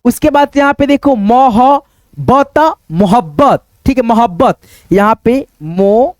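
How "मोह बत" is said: मोह-बत- slowly, piece by piece, left hanging; slow separated syllables in मोह-बत-; sounding out. Shown as a demonstration. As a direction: spell out the sounds of मोह-बत- before blending. Teacher sounding out letters.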